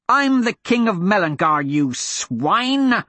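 high, indignant royal shout; outraged prince. Acted. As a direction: spit out the insult like a wounded king.

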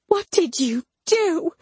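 high, strained angry demand; furious accusation. A narrator's reading. Mama stomping down to confront narrator, furious and high. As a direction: pitch it way up, outraged.

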